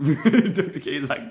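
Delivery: high-pitched giggle while talking; cracking up mid-sentence; laughing. Real, not acted. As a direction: start the quote through laughter. Laughing at a chatter's reaction.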